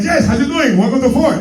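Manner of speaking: mock Spanish-accented salesman. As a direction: greet customers in a put-on Spanish accent. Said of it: "I would do a Spanish accent".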